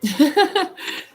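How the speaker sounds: short amused laugh; quick real laugh. Real, not acted. Laughs to herself on camera.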